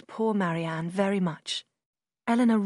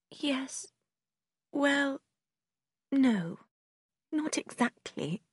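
high, soft, halting admission; hesitant sister; 5 semitones higher, 3 dB quieter, much slower than usual. A narrator's reading. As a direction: concede reluctantly, with long pauses.

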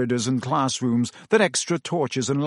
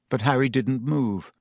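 ordinary delivery

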